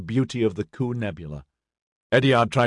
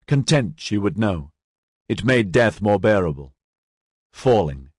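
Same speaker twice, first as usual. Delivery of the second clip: ordinary delivery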